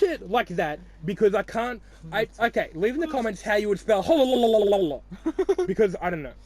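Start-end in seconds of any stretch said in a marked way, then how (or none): 4.1-5.6 higher, louder turkey gobble; turkey noise. Retold or imitated. Asking viewers to spell the noise, turkey gobble. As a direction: gobble it out again. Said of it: "turkey noises".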